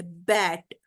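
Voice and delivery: बैट as one low beat; single syllable. Shown as a demonstration. Teacher showing learners one sound, बैट as a single low beat. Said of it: "one sound निकल रही है".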